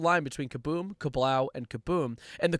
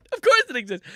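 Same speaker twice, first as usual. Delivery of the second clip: much higher, louder exclamation; exaggerated incredulity; 12 semitones higher, 7 dB louder than usual. Comic finds the lyrics page exists, exaggerated incredulity. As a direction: squeal it in comic disbelief. Repeats it with escalated disbelief.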